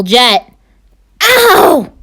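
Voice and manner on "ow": sudden high yelp of pain; startled ouch, pitched high. Acted. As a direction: yelp as if you just hit something. Bumps into invisible jet.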